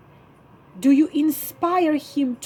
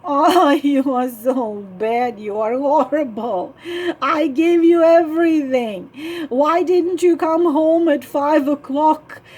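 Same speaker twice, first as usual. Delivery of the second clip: whining; 3 semitones higher, 5 dB louder than usual. Retold or imitated. Wife whining at husband who came home late, tearful whine. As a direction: reproach him through near tears. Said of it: "if you're whining".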